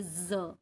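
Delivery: ज as a buzzing z like English zed; z as in zebra; z sound. Shown as a demonstration. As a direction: voice it as z, not j. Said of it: "हम जेड".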